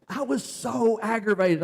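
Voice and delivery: ordinary talk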